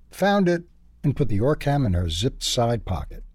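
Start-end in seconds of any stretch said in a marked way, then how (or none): 0.1-0.6 higher, bright found-it cry; triumphant. A narrator's reading. Sherry pleased to find her Orcam, triumphant. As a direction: announce it like a small victory. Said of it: "Sherry spoke triumphantly".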